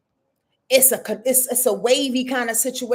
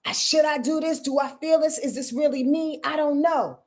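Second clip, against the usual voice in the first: higher-pitched mock dithering; acted self-doubt; 4 semitones higher than usual. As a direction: voice someone's wavering inner questions, pitched up. Voices the audience's self-doubt.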